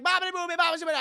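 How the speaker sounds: high, loud mock Italian babble; gibberish Italian woman. Woman at the window babbling fake Italian, high and loud. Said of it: "And she's like".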